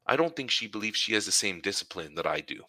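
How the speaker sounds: ordinary talk, nothing marked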